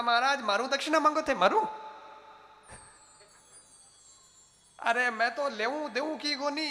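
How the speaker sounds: loud, high, blustering challenge; acted outraged bellow; angry threat. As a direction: round on the saints at full voice. Ajamil angrily threatens the saints.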